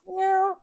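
high squeaky meow; silly high-pitched meow; dinosaur voice. Retold or imitated. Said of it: "I think it was something like".

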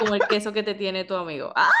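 blurting it out fast while laughing; rushed laughing shout. Real, not acted. Laughing joke about green lips.

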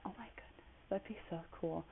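gasped, whispered wonder; hushed, dreamy gasp; breathless awe. Real, not acted. Gasping at the idea of Bali.

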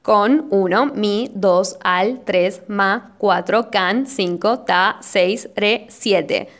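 measured, each syllable followed by its number; syllable count. Shown as a demonstration. Podcaster counting a verse without synalepha, measured syllable by syllable. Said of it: "vamos a contar sin sinalefa".

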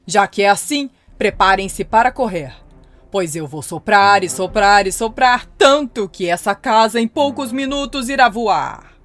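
booming menacing wolf; loud wolf threat. Acted. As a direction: warn them with a big wolf roar. Wolf threatens second house.

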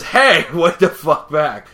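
indignant mock-furious shout; angry shout. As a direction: yell it after someone getting away. Acted angry shout at the arsonist.

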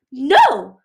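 loud, high shriek of rage. A narrator's reading. Queen shrieking as she attacks, loud and high. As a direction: scream it at them. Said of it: "Scarlet shrieked".

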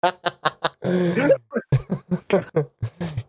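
high delighted laugh. Real, not acted. Game master laughing at the roll, delighted high laugh. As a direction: burst out laughing.

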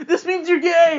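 high, loud mock wail; hammed-up accusation through laughter; hysterical wail. Freaked-out girlfriend wails, players laughing.

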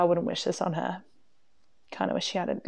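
a whispered confession to the listeners; whispered aside. Acted. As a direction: whisper "Kinda wish she hadn't" as a secret aside. Repeated as a hushed aside.

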